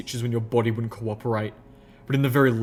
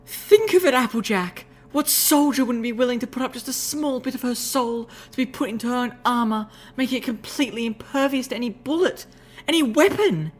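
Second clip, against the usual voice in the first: high, excited lady's voice; rapturous; 13 semitones higher than usual. Acted. Rarity selling her idea to Applejack, high rapturous voice. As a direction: gush with excitement, pitched high. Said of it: "positively glowing in the rapture".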